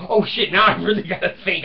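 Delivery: loud, high startled wife's reply; mock alarmed wife. Wife startled by his riddle, loud high outburst.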